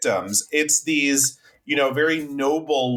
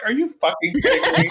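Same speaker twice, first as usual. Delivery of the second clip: incredulous outburst; 11 semitones higher, faster than usual. Retold or imitated. Podcaster mocking an absurd film plot to co-hosts, incredulous. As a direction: throw it out as an exasperated, disbelieving question.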